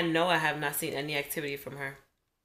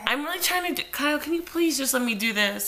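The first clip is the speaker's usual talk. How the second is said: exasperated; 6 semitones higher than usual. Real, not acted. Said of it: "I am now aggravated".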